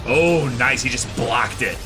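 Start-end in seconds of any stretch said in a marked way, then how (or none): none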